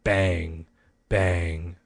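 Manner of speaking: plain reading voice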